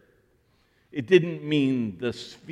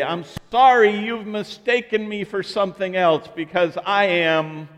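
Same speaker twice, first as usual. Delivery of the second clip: loud, higher mock protest; mock indignant; 4 semitones higher, 6 dB louder than usual. Pastor showing his shirt to the congregant, mock protest. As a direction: burst out in fake offense.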